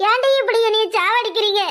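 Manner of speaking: usual speaking voice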